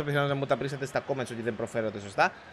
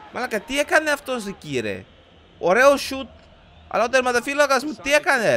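high-pitched, agitated exclaiming; raised, astonished voice; incredulous complaint; 9 semitones higher, 5 dB louder than usual. Real, not acted. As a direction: protest the keeper's mistake loudly. Reacting to keeper's mistake.

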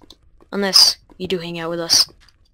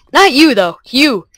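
louder and higher, punching not; emphatic repeat, louder and higher; 5 semitones higher, 7 dB louder, slower than usual. Shown as a demonstration. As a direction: declare it loudly.

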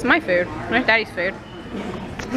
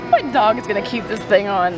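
ordinary talk

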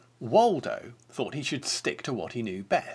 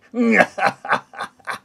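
loud, high villain's cackle; wicked wolf cackle; evil laugh; 9 semitones higher, 10 dB louder than usual. A narrator's reading. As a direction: cackle like a wolf who has won. Wolf gloats after his plan.

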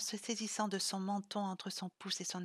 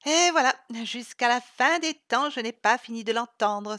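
loud, higher, fond mockery; affectionate teasing; 4 semitones higher, 12 dB louder than usual. Isa teasing Sylvie about her powers, affectionate mockery. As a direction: rib her friend lovingly. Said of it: "se moqua affectueusement Isa".

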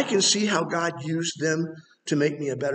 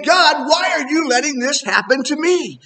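plaintive cry to God; 7 semitones higher, 5 dB louder than usual. Retold or imitated. Believer questions God in pain.

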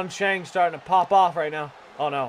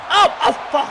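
sudden much higher, louder swear; shocked cry; 11 semitones higher, 7 dB louder than usual. Real, not acted. Commentator seeing a head bounce off the ring, shocked yelp. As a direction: wince at the impact out loud.

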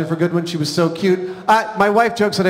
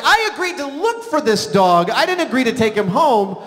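high-pitched, exasperated objection; mock outrage, pushed up high; acted indignant protest; 6 semitones higher than usual. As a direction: protest like a husband being railroaded. Acts out his protest to wife.

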